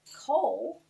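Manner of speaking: usual speaking voice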